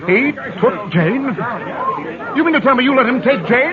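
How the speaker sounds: can't believe what he's hearing; alarmed disbelief. Acted. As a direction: fiance confronting the driver in alarm. Fiance learns Jane was kidnapped.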